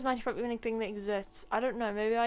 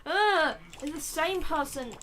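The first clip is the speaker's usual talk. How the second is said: sudden loud outburst; 5 semitones higher, 11 dB louder, slower than usual. Real, not acted.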